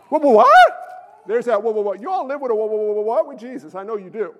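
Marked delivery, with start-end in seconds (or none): 0.1-0.7 high, loud, startled outburst; out-loud alarm. Acted. Gideon told to pare down his army, loud startled disbelief. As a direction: yelp it in disbelief, rising on "what". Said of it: "I just say it out loud".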